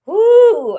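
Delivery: loud, high-pitched whoop; exasperated whoo. Retold or imitated. Retelling frustration with an app.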